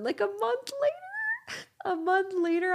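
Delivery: high squeaky giggling words; can't get it out for laughing; laughing through it. Real, not acted. Laughing to listeners about husband David.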